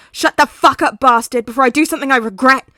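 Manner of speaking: loud, fast angry shout; furious yelling. Acted. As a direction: scream it in his face. Katsuki snarls.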